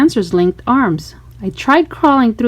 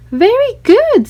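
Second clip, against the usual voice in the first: high, bright praise from the teacher; teacher's praise; 11 semitones higher, 3 dB louder than usual. A narrator's reading. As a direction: praise the class warmly.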